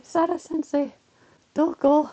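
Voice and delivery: put-on high, slow, childlike pleading; high childlike "Sarah sensei, doko?"; kids missing their teacher. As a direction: voice the students calling for their teacher. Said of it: "they're just like".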